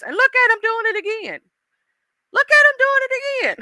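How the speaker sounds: high-pitched incredulous complaint; exasperated God. Acted. God pointing out someone repeating a mistake, high incredulous squeal. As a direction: pitch it way up in mock disbelief. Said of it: "He be like".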